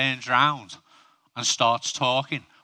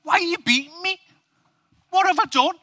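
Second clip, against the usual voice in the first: pleading donkey; 5 dB louder, faster than usual. Acted. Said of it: "The donkey says".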